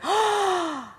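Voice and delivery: high, soft, drawn-out "oh"; delighted surprise. Retold or imitated. Smelling the leaf on her fingers, retold soft high delight. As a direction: re-enact a delighted gasp of recognition.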